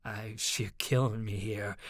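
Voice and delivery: low, soft groan of exasperation. A narrator's reading. Chansey begs Ivy to stop fighting, groaning. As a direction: sag into a weary plea. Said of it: "Chansey groaned".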